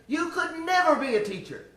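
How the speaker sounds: high, loud imitation of the tester, never stressed; imitating stern tester. Tester tells the girl she can never teach, high and loud. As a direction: hammer it home like a dismissive examiner. Tester dismissing her plans.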